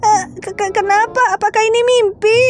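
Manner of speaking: high, startled sister in pain; a frightened girl whose belly suddenly hurts; panicked older sister. Acted. Plays the pregnant sister figure. (kakak perempuan panik bersuara tinggi)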